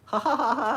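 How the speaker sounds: high imitated giggle "ha ha ha"; guru laugh. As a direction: mimic a blissful guru's laugh. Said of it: "because he just laughs".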